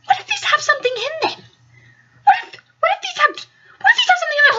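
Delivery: high, rushed, mock-panicked voice. Roommate panicking about the cookie in mock fright. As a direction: pile up the worry, pitched high. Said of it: "she was like".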